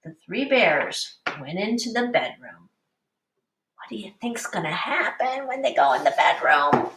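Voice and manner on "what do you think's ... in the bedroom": put-on hushed whisper to the children; secretive storytime whisper; suspense whisper. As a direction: lean in and whisper the question. Teacher builds suspense.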